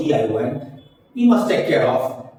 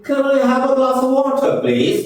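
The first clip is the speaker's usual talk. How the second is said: high, slow put-on American accent; raised, drawn-out American-style request; American English; 8 semitones higher, 3 dB louder, slower than usual. Shown as a demonstration. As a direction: pitch it up into an American impression. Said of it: "और American English".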